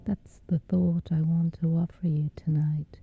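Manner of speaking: ordinary talk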